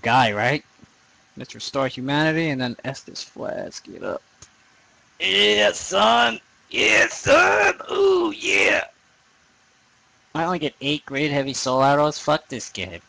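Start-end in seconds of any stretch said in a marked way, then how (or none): 5.2-8.9 high, loud triumphant whoop; excited cheer. Real, not acted. Gamer cheering at the screen, loud and high. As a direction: crow over the win at the top of your voice. Cheering after a game success.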